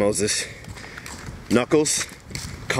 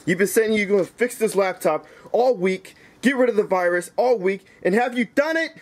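angry tirade rising to a demanding question; heated scolding; worked-up rant. Acted. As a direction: lay into a friend in exasperation. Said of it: "Knuckles, you need to calm down".

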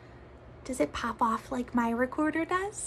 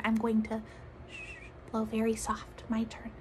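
soft hushed words; soft whisper before the demo; quiet; 3 semitones lower, 9 dB quieter than usual. Shown as a demonstration. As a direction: keep it low and gentle.

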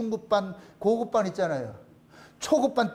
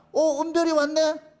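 higher, slow, delighted welcome; tender greeting; 5 semitones higher, slower than usual. Retold or imitated. Pastor welcoming little 은별이, fond and slow. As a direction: greet a toddler fondly. Greeting a three-year-old.

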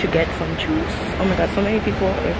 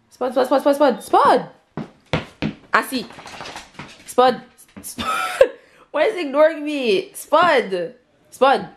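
high-pitched repeated name calls; calling a dog; 7 semitones higher, slower than usual. Real, not acted. Owner calls the dog ignoring her, high insistent calling. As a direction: call insistently, pitch jumping up.

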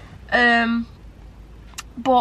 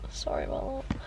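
whispered apology; 2 semitones higher, 9 dB quieter than usual. Retold or imitated. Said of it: "And I was like".

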